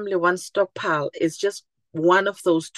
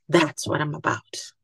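whisper; 2 semitones lower, 3 dB quieter than usual. Acted. Whispered punchline before repeat.

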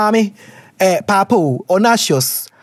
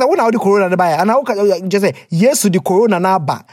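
normal talking voice

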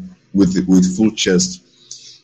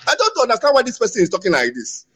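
much higher, hurried mock bewilderment; baffled reaction; 12 semitones higher, faster than usual. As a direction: act out a baffled listener in a high, quick voice. Acts out baffled listeners' reaction.

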